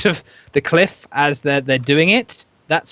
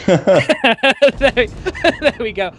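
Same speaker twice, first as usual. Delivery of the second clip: laughing; 8 semitones higher, 3 dB louder, much slower than usual. Real, not acted. Laughing at a ramp gone wrong.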